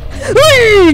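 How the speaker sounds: sudden high yelp of fright; startled yelp. Real, not acted. Gamer startled by a sea monster, yelps. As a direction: jump at the sound and yelp.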